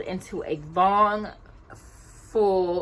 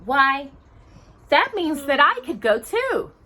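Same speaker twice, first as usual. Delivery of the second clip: excited hope; 8 semitones higher, 6 dB louder than usual. Acted. Cinderella hopeful at ball news.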